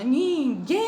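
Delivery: Ninguém with the guém stretched and high; stress on the last syllable. Shown as a demonstration. Teacher stressing the final syllable for the class. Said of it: "Repitam comigo".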